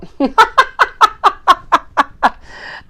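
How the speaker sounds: a high, falling burst of laughter. Real, not acted. Reacting to the chat with a high, loud laugh.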